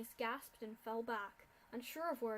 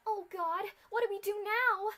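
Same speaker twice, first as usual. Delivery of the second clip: high, loud frightened cry; terrified of the locked door; panicked cry; 9 semitones higher, 10 dB louder than usual. Acted. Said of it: "Aurora cried".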